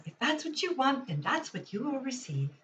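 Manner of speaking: barely-there princess impression, mostly her own voice; Princess Leia. Shown as a demonstration. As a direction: lightly voice a film heroine delivering a line. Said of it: "I have to do the Princess Leia".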